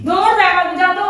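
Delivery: loud, high order to go; sharp burst of irritation; angry dismissal. Pretend. Shoos husband away from her call.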